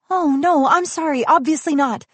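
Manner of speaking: put-on flustered young heroine. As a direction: fluster through the apology. Asha apologizing.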